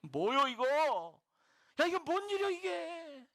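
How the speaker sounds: drawn-out mock Chungcheong dialect, rising high. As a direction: exclaim in hammed-up Chungcheong dialect. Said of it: "충청도 말로 하면". (길게 늘여 놀라는 충청도 사투리 흉내)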